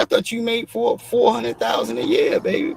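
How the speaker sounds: high sing-song taunt, pitched well up; mocking singsong. Teasing the wife about her salary in a high mocking singsong. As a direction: croon it up high to tease her.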